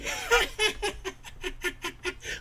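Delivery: high cackling laugh, mocking the politicians; hammed-up gleeful cackle; mock gloating laugh. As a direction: laugh like a villain enjoying a win.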